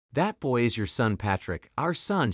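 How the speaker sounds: ordinary delivery, nothing marked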